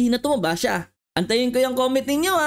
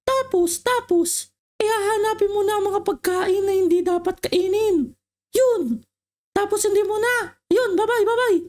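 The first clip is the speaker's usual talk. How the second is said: a much higher put-on voice for the old woman; old lady voice; 7 semitones higher than usual. Old woman telling the kids the symptoms, in a high put-on voice. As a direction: squeak it out like a fussy old lady.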